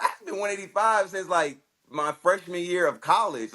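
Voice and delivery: high, slowing protest; incredulous protest. Retold or imitated. Fighter protesting to coach, high disbelieving rant. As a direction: argue it in disbelief.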